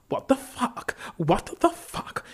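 high, loud university-English outburst. A narrator's reading. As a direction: exclaim it loudly in educated English, high-pitched. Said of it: "in university English".

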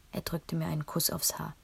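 plain reading voice

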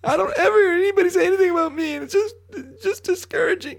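high, wobbly mock sobbing; fake crying. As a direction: whine it like a hammed-up crybaby. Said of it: "It sounds like you're crying right now".

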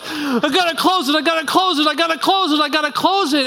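high laughing mock chant; anxious salesman. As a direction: repeat it like a nervous closer. Mocking sales-style evangelism.

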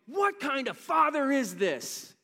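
loud, high, slowed-down incredulous question; shocked disbelief. Acted. Preacher voicing the parable's riveted listeners, loudly incredulous. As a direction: act out an astonished outcry, drawn out.

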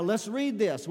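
ordinary talk

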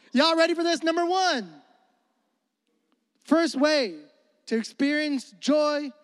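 rally the room; excited hype. Real, not acted. Pastor hyping the congregation before point one, high and excited. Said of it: "hyping the crowd".

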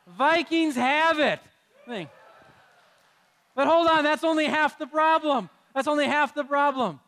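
loud, high proclamation to the crowd; loud ruling. Real, not acted. Pastor announcing the vote to the congregation, booming out. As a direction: rule on it like an umpire calling the result.

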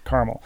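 "caramel" as CAR-mul; "caramel" without its middle vowel, CAR-mul; Minnesota caramel. Shown as a demonstration. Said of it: "growing up in Minnesota".

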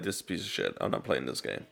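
low, soft mock grumble; a hint of a grumpy player brushing off an old game; mock disgusted gamer. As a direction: mutter it like a scornful gamer. Imagined friend dismisses old game.

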